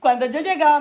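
loud, laughing through the words. Real, not acted. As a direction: laugh as you tell the funny part. Laughing at the pocket-money story.

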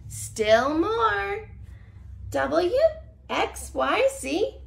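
lively storybook read-aloud; reading to children. Acted. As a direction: read it like a bedtime picture book. Reads picture book to children.

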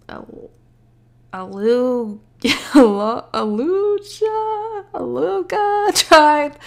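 high, slow sounding-out of the name; drawn-out stumbling. Real, not acted. Reader tries an unknown tribe name, high drawn-out stumbling. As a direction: stretch the name out high and unsure.

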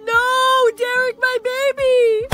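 high, distraught cry; anguished scream. Acted. Girlfriend losing her boyfriend Derek, anguished high scream. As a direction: scream out for a loved one.

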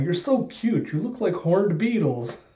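high, cutesy coo; imitated adoring sweetness; Robin, cooing.

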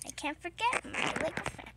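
normal talking voice

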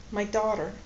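ordinary delivery, nothing marked

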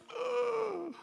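high, drawn-out gasp; mock shocked gasp. Listener shocked at pastor's words, mock gasp. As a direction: gasp as if scandalized.

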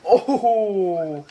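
normal talking voice

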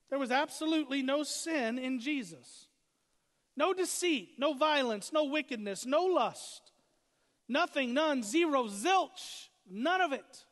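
loud, high, punched-out preaching; emphatic preaching. Real, not acted. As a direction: drive home each item of the list with force. Preacher builds to full force.

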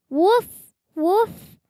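high, bright little yips of a puppy; puppy woof. Acted. Reading a picture book aloud, puppy Biscuit barks with high yips. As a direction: yap it like a happy puppy.

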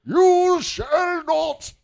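very high, loud, stretched-out wizard bellow; hammed-up wizard command, word by word; Gandalf. Shown as a demonstration. Co-host demonstrating the wizard, loud very slow imitation. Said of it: "too young of a Gandalf".

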